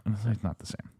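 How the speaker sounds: ordinary delivery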